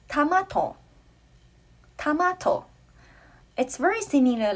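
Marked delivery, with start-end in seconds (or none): 0.1-0.8 tamato said higher, falling; Taiwanese pronunciation. Shown as a demonstration. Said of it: "we say". 2.0-2.7 tamato said high, rising at the end; Taiwanese pronunciation. Shown as a demonstration. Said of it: "we say".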